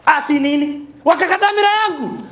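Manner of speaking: high, loud panicked phone yell; frantic Swahili phone shout. Retold or imitated. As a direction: scream down the phone about stolen miraa. Caller shouting over phone.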